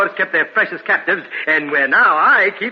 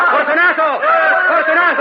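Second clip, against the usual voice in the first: high, loud call over the crowd; 10 semitones higher, 5 dB louder than usual. Acted. Angelo calls Fortunato across the carnival, high and loud. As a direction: shout his name across the revelry.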